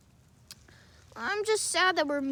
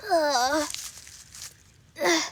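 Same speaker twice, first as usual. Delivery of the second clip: ordinary talk, nothing marked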